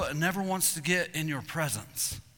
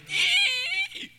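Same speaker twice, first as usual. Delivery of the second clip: high shrill bugle call imitation; distant bull bugle; 5 dB louder than usual. Imitating a long-range bull's bugle, high squeal. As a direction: squeal like a faraway elk bugle. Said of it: "he goes".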